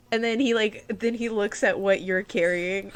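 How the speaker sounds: ordinary delivery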